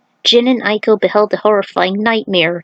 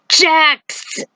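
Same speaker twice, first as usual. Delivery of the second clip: calling out; 7 semitones higher than usual. Acted. A comrade cries out in battle.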